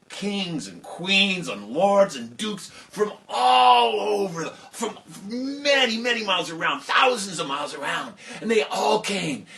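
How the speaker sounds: slow, loud, high sweeping list; big excited build-up; grand listing. A narrator's reading. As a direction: paint the size of the crowd.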